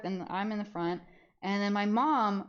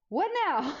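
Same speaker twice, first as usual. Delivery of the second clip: higher exasperated question; weary what now; 8 semitones higher, 4 dB louder than usual. Retold or imitated. Teen to frustrated mom, higher exasperated question. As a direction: ask it already fed up. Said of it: "And I was like".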